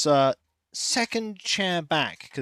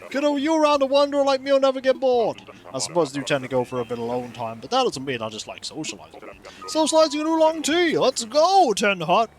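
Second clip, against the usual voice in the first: chatty wanderer villager; 6 semitones higher, faster than usual. Acted. Wanderer telling a kiddo about socializing, hearty. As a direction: play a sociable wanderer with big pitch swings. Reads a game villager's dialogue aloud.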